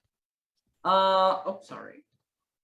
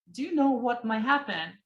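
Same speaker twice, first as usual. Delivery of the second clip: normal talking voice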